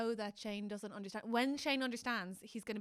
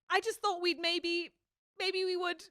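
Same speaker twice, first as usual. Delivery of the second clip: high, slow and hopeful, a touch louder; wistful Shane; 8 semitones higher, 6 dB louder than usual. Retold or imitated. Friend quotes Shane's heartbreaking line, high and hopeful. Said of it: "the thing that breaks my heart is when he goes".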